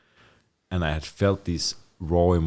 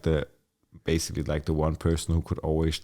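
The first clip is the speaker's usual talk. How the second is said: ordinary delivery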